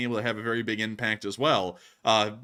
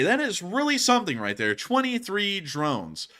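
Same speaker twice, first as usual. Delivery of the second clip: ordinary delivery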